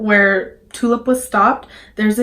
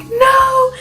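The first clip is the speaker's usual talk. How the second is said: high, loud wailed no; acted yell; 5 dB louder than usual. Driver yelling in her stalling car, high acted yell. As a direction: yell it high in mock panic. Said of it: "I'm, like, yelling in my car".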